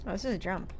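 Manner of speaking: ordinary delivery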